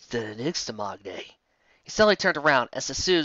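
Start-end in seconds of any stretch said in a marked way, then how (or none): 0.1-1.3 low grim murmur. Acted. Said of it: "Bulwark breathed out".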